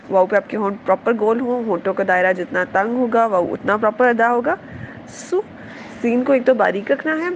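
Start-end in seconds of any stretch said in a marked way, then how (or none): none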